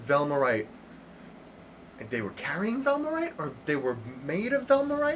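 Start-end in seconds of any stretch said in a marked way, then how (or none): 2.1-5.2 high, sharply rising questions; double-check it as if hardly believing it; disbelieving questioning. Acted. Enthralled listener confirms the report with the witnesses, in disbelief. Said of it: "is almost in disbelief".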